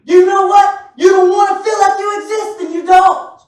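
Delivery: loud, high preaching; preaching shout. Acted. Preacher challenging the congregation, loud and high. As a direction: hammer it at the pews.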